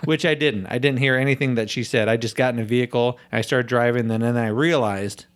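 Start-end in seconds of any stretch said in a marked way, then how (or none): none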